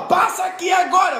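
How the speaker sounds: high, shouted order; commanding father. Acted. Father ordering child over, high acted shout. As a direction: call the child over sharply, pitched high. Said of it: "Quando o pai fala".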